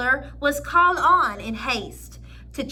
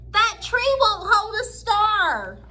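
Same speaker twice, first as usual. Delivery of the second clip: higher and a bit louder call; cried out; 7 semitones higher, 4 dB louder than usual. A narrator's reading. Little Bear calling out from afar, a raised cry. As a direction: call it across the room. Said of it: "cried out".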